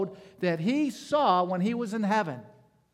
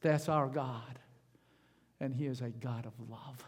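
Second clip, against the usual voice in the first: ordinary talk, nothing marked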